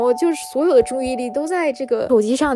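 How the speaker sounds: ordinary talk, nothing marked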